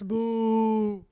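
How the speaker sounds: drawn-out playful boo; mock booing. Vlogger jokingly rejecting American accents, mock boo. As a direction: boo like a heckler in jest.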